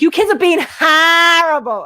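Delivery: teasing the way he yells horrible; imitating his yell. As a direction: play the dad scolding the kids, slowed. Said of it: "yelling at the kids, he's like".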